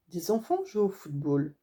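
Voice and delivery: ordinary delivery, nothing marked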